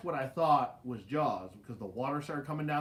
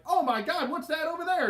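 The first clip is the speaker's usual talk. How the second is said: high, excited tour guide exclaiming; mock alarmed ride announcer gasping; 12 semitones higher, 4 dB louder than usual. Ride announcer on the mic, fake alarm at the billboard. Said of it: "the guy on the microphone was like".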